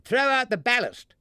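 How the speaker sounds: high, loud urgent command; shouted order. A narrator's reading. Barnaby's father ordering the ballast overboard, loud shout. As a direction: bark the order to lighten the load. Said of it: "shouted Barnaby's father".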